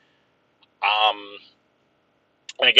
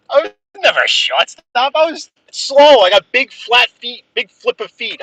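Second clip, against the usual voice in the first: indignant; 10 semitones higher, 3 dB louder than usual. Real, not acted. Denying he is a shortstop.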